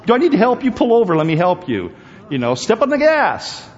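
high, loud, fast mock cop; mock police. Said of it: "the police will actually come up alongside".

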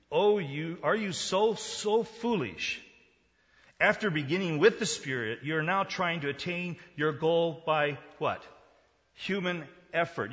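rebuking quote. Acted. Reading Paul's rebuke of the Galatians.

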